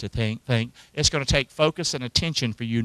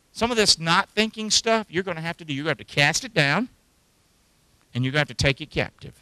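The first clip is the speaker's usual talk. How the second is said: ordinary talk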